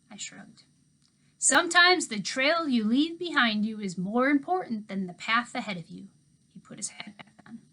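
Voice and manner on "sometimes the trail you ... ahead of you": put-on louder, proud old handyman sharing wisdom; folksy motto. A narrator's reading. Old handyman telling the girl his motto, louder and proud. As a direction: savor the motto like it matters.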